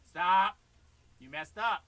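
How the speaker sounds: loud, firm rebuke; loud scolding stop. Real, not acted. Teacher scolding the student for messing up, loud. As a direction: call out the mistake sharply.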